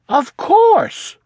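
high chirpy Froggy voice; cocky of course. Acted. Froggy answering his mother, high and breezy. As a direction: toss it off, high and sure.

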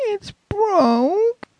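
put-on high, crying little boy over a broken pole; child crying. Boy to dad about broken toy, crying. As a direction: whimper it like a child about to sob. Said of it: "started to cry".

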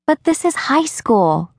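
Rachel's voice. Acted. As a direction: nudge her gently toward the dance.

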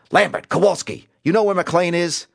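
high-pitched, urgent yell; yelling to another room. A narrator's reading. Cobb summons detectives from the next room, yelling. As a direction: call out across the office. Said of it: "yelled into the other room".

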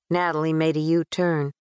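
usual speaking voice

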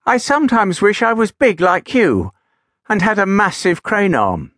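high, wistful small engine; little engine voice. Acted. Little engine telling Gideon his wish, high and wistful. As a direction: lift the pitch, a tiny engine wishing. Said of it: "said Little Titch".

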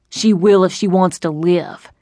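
a hint of cold menace. A narrator's reading. Robber threatening the old woman's life, cold. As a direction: threaten without raising the voice. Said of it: "The cold tone in his voice".

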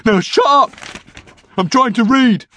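loud, high, fed-up order; irritated outburst. A narrator's reading. Norm trying to read on the toilet, irritated. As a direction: shout him down crossly. Norm telling Brian to shut up.

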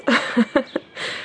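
a quiet breathy giggle; soft happy laugh. Acted. Jane to Mrs Fairfax about the engagement, laughing softly. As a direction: laugh off her surprise.

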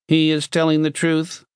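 high, monotone machine voice; toneless metal box. A narrator's reading. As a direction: intone it like a talking machine. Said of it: "the metal box said tonelessly".

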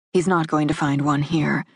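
ordinary delivery, nothing marked

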